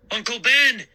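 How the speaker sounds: high, loud frantic call; frantic shout. A narrator's reading. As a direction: shout his name in panic. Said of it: "I shouted frantically".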